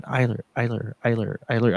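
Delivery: a hushed, faraway repeat of a name; soft inner voice. Retold or imitated. Inner voice feeding the name, said softly. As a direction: murmur it like a voice in your head. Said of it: "My little inner voice was like going".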